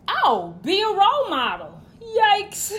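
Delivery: loud, high exclamation into laughter; high-pitched sarcastic reaction; mocking amusement. Real, not acted. Mocks the next tip, laughing.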